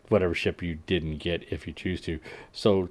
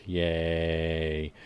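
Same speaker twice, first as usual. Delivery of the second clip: deadpan yay; 4 semitones lower, 5 dB quieter than usual. Acted. Unenthused cheer at arena news.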